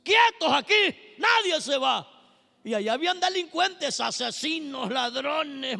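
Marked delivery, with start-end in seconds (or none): 0.0-2.1 high, loud call to stop the prisoners; Paul shouting at the fleeing prisoners to stay; commanding shout. Acted. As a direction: bark at them to stay put. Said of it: "se para y les dice".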